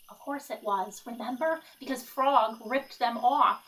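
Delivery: usual speaking voice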